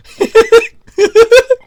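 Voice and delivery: high burst of laughter; loud cackling laugh. Real, not acted. Laughing at game sound.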